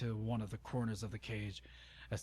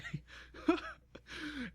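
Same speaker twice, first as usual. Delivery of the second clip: high, breathy laugh; helpless giggle; 3 dB quieter than usual. Real, not acted. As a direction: give in to the giggles mid-reading. Reader gives in to laughter.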